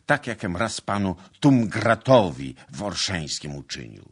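heated confession. A narrator's reading. Suitor confessing the rout to his lady, loud and eager. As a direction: pour out the misdeeds at high volume.

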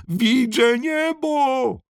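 high, slow shout of wonder; calling out. Acted. Turtle flying upside down, shouting high at the sky. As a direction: shout it high, stretching the vowels. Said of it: "wołał".

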